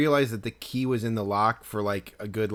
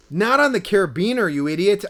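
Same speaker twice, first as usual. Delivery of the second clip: loud, high exasperated correction; retold telling-off of a friend; hostile scolding; 7 semitones higher, 6 dB louder than usual. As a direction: scold him, pitched up and loud. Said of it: "getting hostile with him".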